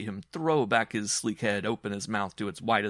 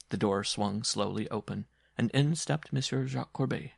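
ordinary delivery

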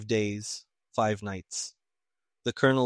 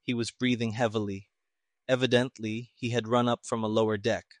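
usual speaking voice

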